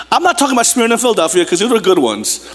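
ordinary talk

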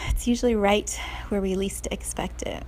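usual speaking voice

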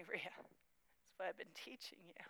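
whispered voice of God. Acted. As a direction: breathe it as a gentle whisper. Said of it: "he said to me".

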